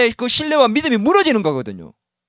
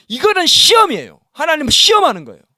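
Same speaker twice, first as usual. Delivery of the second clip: emphatic declaration; 5 semitones higher, 5 dB louder than usual. Real, not acted. Preacher drives home point.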